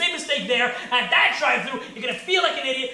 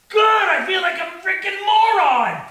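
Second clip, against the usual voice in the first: loud, high, drawn-out self-disgust; exasperated outburst; 5 semitones higher, 6 dB louder than usual. Acted. Comic berating himself over a drive-thru slip, exasperated. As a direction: groan it out at full volume, stretching the words.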